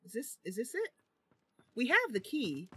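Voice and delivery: ordinary talk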